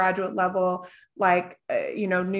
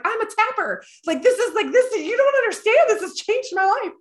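much higher, louder gushing convert; ecstatic client; 13 semitones higher, 4 dB louder than usual. Retold or imitated. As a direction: squeal it like a life changed. Client returns converted, crying.

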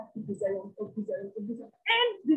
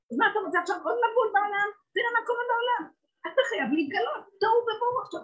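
high, fast, pressing sermon; urgent preaching; 9 semitones higher, 3 dB louder, faster than usual. Acted. Preacher warning the crowd of chaos, high and rushed. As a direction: push the warning at them fast.

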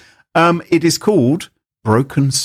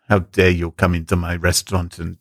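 put-on French accent; 6 semitones lower than usual.

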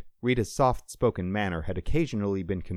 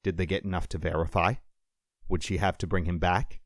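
ordinary delivery

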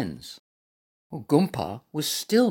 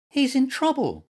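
high-pitched worried squeak; small creature raising the alarm; alarmed little animal cry; 10 semitones higher than usual. A narrator's reading. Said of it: "cried Little Mutt".

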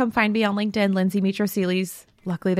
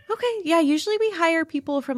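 mock manager; 8 semitones higher than usual. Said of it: "And he's like".

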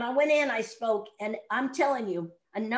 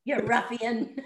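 ordinary talk

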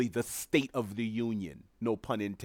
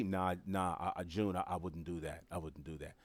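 lower, much softer, fading refusal; imagined reply; 5 semitones lower, 9 dB quieter than usual. Pretend. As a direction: murmur the refusal, trailing away. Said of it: "and you say".

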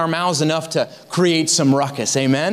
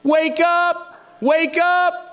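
much higher urgent call; rising wake-up call; 9 semitones higher, slower than usual. Retold or imitated. The burning one rousing sleepers, urgent high call. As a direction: climb higher on each wake up. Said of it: "he shouted".

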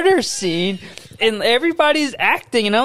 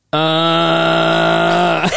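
long, low, steady groan; low drawn-out "uhhh"; mock dying groan; 4 semitones lower, 3 dB louder than usual. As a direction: groan like a bad actor playing wounded. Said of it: "[name]'s going".

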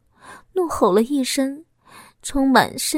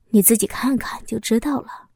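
ordinary delivery, nothing marked

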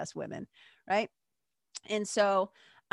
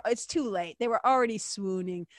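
ordinary talk

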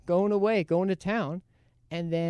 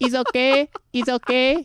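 high-pitched, repetitive placating voice; imitated worker; 9 semitones higher, 4 dB louder than usual. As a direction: mimic the caught worker insisting in a high voice. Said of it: "He just keeps saying".

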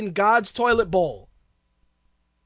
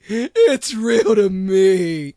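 slow, loud, lower wail of a true believer; mock devoted fan; 4 semitones lower, 6 dB louder, much slower than usual. Shown as a demonstration. Mock wrestling fan insisting it is real, slow and loud. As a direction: drag it out like a crushed fan. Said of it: "The shit is fake".

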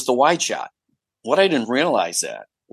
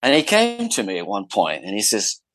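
ordinary delivery

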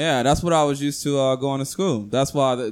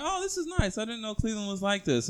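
surprised; 7 semitones higher, 8 dB quieter than usual. Retold or imitated. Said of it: "I'm like".

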